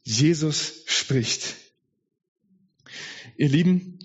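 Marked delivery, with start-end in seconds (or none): 0.0-1.6 very slow, each word weighted; solemn slow proclamation. Acted. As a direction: let it land slowly. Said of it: "slow emphatic proclamation". (langsam und feierlich verkündet)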